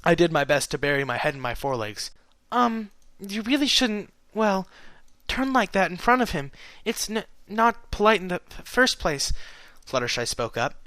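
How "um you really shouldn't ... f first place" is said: high, hesitant character voice with mild sternness; lightly stern, halting and pitched up; timidly stern. A narrator's reading. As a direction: scold gently, stumbling over the words. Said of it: "the closest I'd heard to a stern voice from her".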